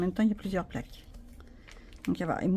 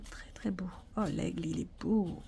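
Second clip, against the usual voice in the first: whispering; 6 dB quieter than usual. Real, not acted. Admiring cards softly.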